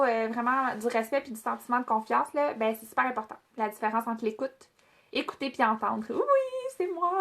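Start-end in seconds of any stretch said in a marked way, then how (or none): 6.1-7.1 surprised yelp. Real, not acted. Suddenly called on mid-lesson.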